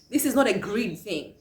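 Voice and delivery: ordinary delivery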